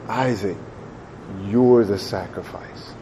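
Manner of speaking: low, hushed, slow and grieving; Abraham crying. Acted. Father to his son on the altar, tearful hush. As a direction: break the news softly, slowly, heavy with sorrow. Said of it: "He's crying".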